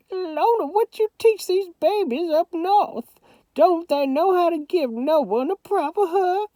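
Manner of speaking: put-on high old Southern grandmother voice; Southern grandma. A narrator's reading. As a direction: scold lovingly as a granny.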